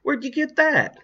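high, loud astonished question; astonished and shrieky; surprised cry. A narrator's reading. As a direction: demand it in amazement, voice leaping up. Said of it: "cried in surprise".

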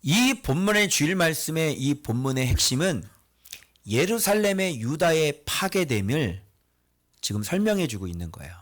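ordinary delivery

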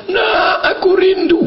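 high, drawn-out call of longing; hammed-up motherly longing. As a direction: call it down the phone, pitched high. Imagined mother calling out on phone.

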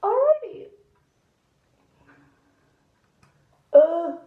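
sudden high loud shout; loud yelp. Real, not acted. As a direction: blurt it out loud. Loud outburst under mask.

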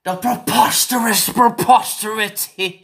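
hammed-up formal indignation; mock pompous orator. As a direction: deliver it like an outraged senator. Mock pompous outrage speech.

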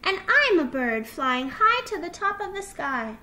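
high, loud, soaring little fox; excited shouting. A narrator's reading. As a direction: play at flying with childlike glee. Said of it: "shouted Little Fox".

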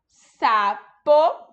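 "sapo, lesma, gafanhoto, caracol" drawn out with rising ends and long pauses; syllable by syllable. Shown as a demonstration. Teacher checks answers with pupils, "sapo, lesma, gafanhoto, caracol" in slow syllables. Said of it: "então vamos conferir?".

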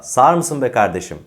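loud irritated driver's outburst, lightly acted; mock exasperation. Teacher playing a honking driver, mock exasperated. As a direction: protest loudly like a fed-up motorist. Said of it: "böyle bir isyanda bulunursunuz".

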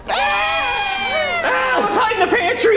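very high, shrieking panic; little-girl scream. Acted. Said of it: "scream like little girls".

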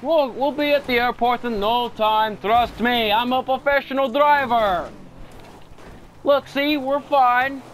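a cocky Russian driver swearing he's a professional; Russian accent. Pretend. Reassuring his crashing passenger in a Russian accent. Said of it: "back to Russian accent".

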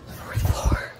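winded, barely voiced; out of breath. Real, not acted. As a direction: gasp it out, out of breath. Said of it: "I'm out of breath".

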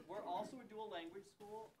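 normal talking voice

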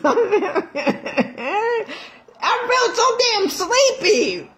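high, slow, laughing squeal; a laughing whine about being so sleepy. Real, not acted. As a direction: giggle through the words.

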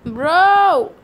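loud startled yell; panicked shout. Real, not acted.

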